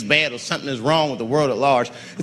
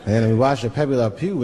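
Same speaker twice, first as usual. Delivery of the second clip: usual speaking voice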